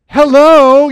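very loud, high, drawn-out hello; alarmed yell. Acted. Father when nobody responds to the emergency, alarmed yell. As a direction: yell down the corridor for help.

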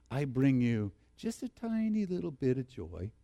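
a timid, half-hearted tiny announcement; mock understated angel. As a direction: say it small, like it barely matters. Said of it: "Is that what he says?".

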